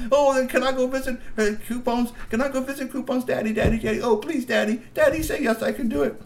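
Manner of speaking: high, whiny pleading for daddy; begging child. Acted. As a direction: nag daddy insistently. Girl begs again.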